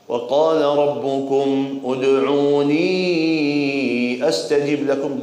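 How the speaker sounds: slow, chanted Arabic verse; Quran recitation. Acted. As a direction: intone the verse reverently.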